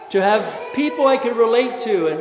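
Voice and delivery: normal talking voice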